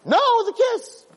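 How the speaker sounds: high, bright-voiced certainty; joyful insistence. The forgiver insisting to the offender, high and joyful. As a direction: insist happily it was love.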